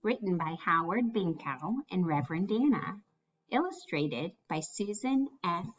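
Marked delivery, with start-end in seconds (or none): none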